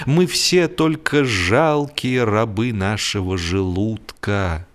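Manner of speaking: usual speaking voice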